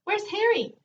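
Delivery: high squawky parrot call. A narrator's reading. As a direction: squawk it out like a talking bird. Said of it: "said the parrot".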